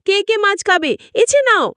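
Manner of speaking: high, hurried call to the crowd; witch calling out. Acted. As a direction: shout out offering the fish in a rush. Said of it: "চেঁচিয়ে বলল".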